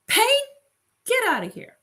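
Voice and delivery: high, loud call, then a firm order; playful bossy send-off; acted dismissal. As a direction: show an unwanted guest the door. Acts ordering Pain out.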